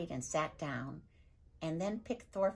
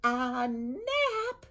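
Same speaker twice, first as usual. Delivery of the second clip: higher, louder outraged disbelief; raging; 8 semitones higher, 6 dB louder than usual. Acted. Viking father hearing his wife is napping, raging. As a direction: spit it back in rage. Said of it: "spitting with rage now".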